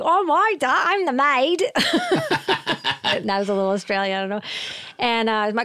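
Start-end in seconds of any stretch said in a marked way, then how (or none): 0.0-1.6 high, hammed-up Cockney maid, a little louder; bad British accent. Teen at audition hamming a British maid accent. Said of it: "British accent and be like". 1.7-3.0 high burst of laughter at her own bad accent. Real, not acted. Guest laughing at her old audition accent.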